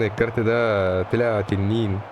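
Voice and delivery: ordinary talk, nothing marked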